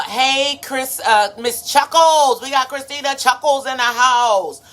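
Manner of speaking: high, loud shout-out to a newcomer; excited greeting. Real, not acted. Live host greeting a viewer who joined, excited and loud.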